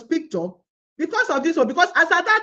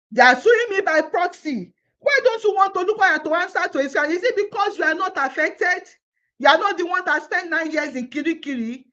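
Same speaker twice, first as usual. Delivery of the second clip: ordinary talk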